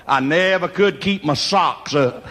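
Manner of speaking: ordinary talk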